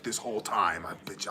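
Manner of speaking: whispered traitor confession; mock sinister whisper; conspiratorial whisper. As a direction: breathe it like a mole revealing himself. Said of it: "He's like".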